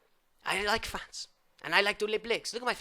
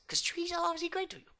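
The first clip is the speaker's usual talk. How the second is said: whisper; 10 semitones higher, 5 dB quieter than usual. Acted. Secretive aside.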